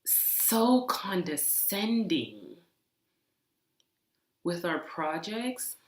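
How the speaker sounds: slow, stretched So condescending; slow, fed-up So condescending; drawn-out exasperation. Real, not acted. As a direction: drag out So condescending in disbelief. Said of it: "but I'm really pissed off".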